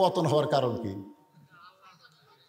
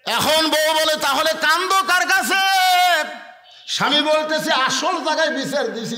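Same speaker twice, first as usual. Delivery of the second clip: high, loud sermon cry held on one note; full-force preaching; 9 semitones higher, 5 dB louder than usual. Acted. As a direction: declaim it high over the crowd. Preacher declaims couple's dialogue.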